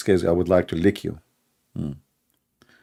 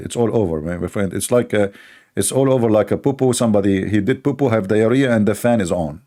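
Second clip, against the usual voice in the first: usual speaking voice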